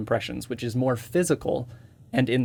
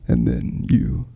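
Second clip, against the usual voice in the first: Corpse voice. Shown as a demonstration. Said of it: "then you have the Corpse voice".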